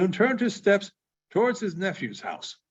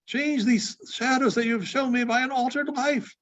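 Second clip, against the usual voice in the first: put-on higher, pleading old man; desperate Scrooge; 5 semitones higher than usual. Scrooge pleading with the spirit, rising desperately. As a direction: beg for your life, rising to a cry.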